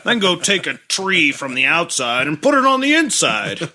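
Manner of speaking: put-on high, dopey drunk dad. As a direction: play a clueless drunk dad. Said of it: "He's like".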